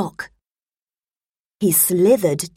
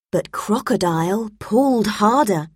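ordinary delivery, nothing marked

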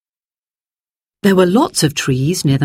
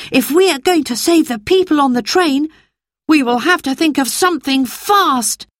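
put-on much higher boy's voice, pressing; urgent boy Peter; 9 semitones higher than usual. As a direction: hurry the others along.

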